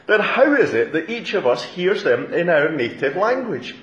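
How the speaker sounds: high-pitched, incredulous questioning; utterly amazed. Acted. Onlookers asking about Galileans speaking their language, amazed. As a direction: ask it in open astonishment. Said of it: "utterly amazed, they asked".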